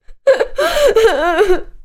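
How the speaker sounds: high, loud blubbering sob; weepy sob-laugh. A narrator's reading. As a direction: wail like a self-pitying crybaby. Mister Miserable breaks down.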